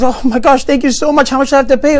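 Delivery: high, gushing thanks; overjoyed gratitude. Acted. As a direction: act out an alien bowled over by generosity. Alien gushes thanks for food.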